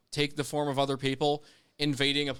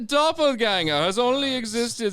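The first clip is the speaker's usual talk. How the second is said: put-on German accent; 7 semitones higher, 4 dB louder than usual. Host teasing his German cohost with a mock German accent. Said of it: "you want to hear my German accent?".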